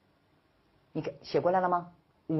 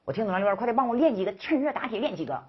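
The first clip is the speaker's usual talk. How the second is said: higher, pleading student voice; eager student voice; 6 semitones higher, faster than usual. Pretend. Teacher voicing a student who wants more exercises, eager. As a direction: play the eager student begging for practice.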